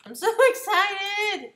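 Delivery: mock excitement. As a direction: overdo the excitement, stretching the words. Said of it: "Not really".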